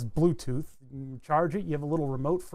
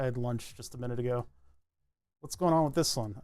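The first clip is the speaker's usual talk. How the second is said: normal talking voice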